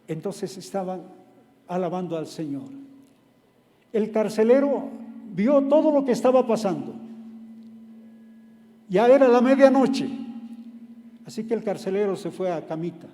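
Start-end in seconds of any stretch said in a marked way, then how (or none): 3.9-10.1 louder, higher dramatic narration; preacher building the midnight scene; building preaching. Acted. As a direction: raise the tension as the story builds.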